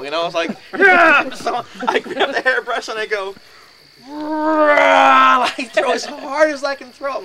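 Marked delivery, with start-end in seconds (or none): 0.8-1.3 high, loud roaring "yeah"; battle yell. Retold or imitated. Comedian acting out his brave charge with a hairbrush, battle yell. 4.1-5.5 long, loud, high roar; battle roar. Retold or imitated. Comedian acting out hurling a hairbrush, drawn-out battle roar.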